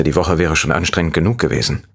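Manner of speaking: ordinary delivery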